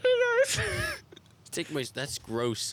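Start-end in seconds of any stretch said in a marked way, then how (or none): 0.0-0.6 loud, very high puppet squeak; ventriloquist attempt. Shown as a demonstration. Trying friend's ventriloquism test, loud squeaky puppet voice. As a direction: push it out high without moving lips. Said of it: "make it look like your mouth is moving".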